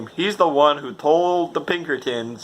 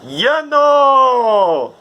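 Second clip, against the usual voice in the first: high, loud goofy yell of "You know!"; comic drunk shout; 12 semitones higher, 7 dB louder than usual. Retold or imitated. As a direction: belt it out like a silly drunk. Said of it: "it was so funny where it says".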